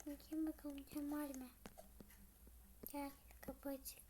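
soft unvoiced whisper at the door; hushed whisper. Someone knocks, she whispers at the door. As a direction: whisper as if someone might hear.

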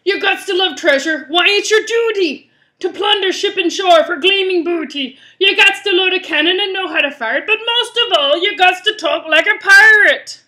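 rally the crew as a rough pirate. Acted. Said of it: "doing this pirate voice".

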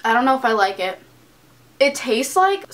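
speaking between bites; talking while chewing. Real, not acted. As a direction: mouth partly full of food. Seen 1: Bite at +1.2, chews to ~2.8; later lines spoken mouth empty.

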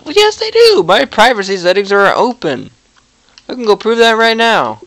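high, loud, indignant retort. Real, not acted. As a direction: snap back at the screen, outraged. Arguing back at the error message.

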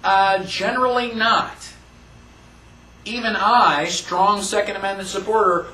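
usual speaking voice

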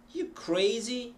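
CRAZY high and rising; question without "are". Shown as a demonstration. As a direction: ask it purely by lifting "crazy". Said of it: "You take off the R".

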